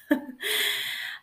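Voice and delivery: high, airy breathy chuckle; breathy laugh. Real, not acted. After reassuring herself.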